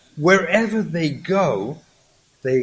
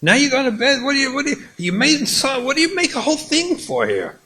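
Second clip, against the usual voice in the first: the husband blowing up at the ruined evening; angry husband; 10 semitones higher, much faster than usual. Acted. Acted marital quarrel.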